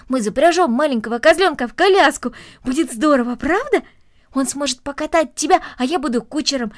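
excited planning. Acted. Boy proposing to harness the kid to a cart, excited. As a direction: pitch the plan with boyish eagerness.

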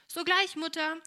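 keen daughter calling back to her mother; eager reply. A narrator's reading. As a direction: chirp back to mother, ready to help. Helene answers mother brightly.

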